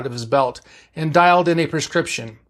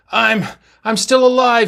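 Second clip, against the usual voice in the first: higher, halting, lightly acted gasping words; short of breath; 8 semitones higher, 5 dB louder than usual. A narrator's reading. Badly injured Sulu answering, mildly short of breath. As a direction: push the words out with failing breath. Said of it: "with what breath he had left".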